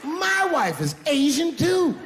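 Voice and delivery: high-pitched, crowing punchline; triumphant reveal. Acted. Comedian springing the surprise on his accuser, triumphant. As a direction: crow it at her in a high, gleeful shout.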